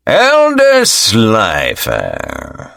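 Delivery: slow, drawn-out sneer. Acted. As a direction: sneer the name slowly. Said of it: "the man sneered".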